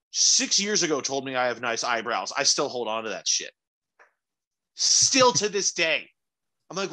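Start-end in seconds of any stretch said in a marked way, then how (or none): 4.7-6.1 emphatic. Real, not acted. Emphatic proud aside.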